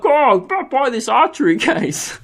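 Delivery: high, slow put-on voice breaking into laughter; mocking impression of shop worker. Shop worker pushing an archery case, in a mocking voice. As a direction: caricature him high and drawn-out, then crack up.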